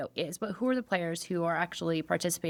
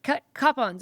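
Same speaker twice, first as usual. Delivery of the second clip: higher-pitched imitation of a sitcom man; Schmidt; 6 semitones higher, 3 dB louder than usual. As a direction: put on his voice, higher, saying CUP-pons. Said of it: "And he goes".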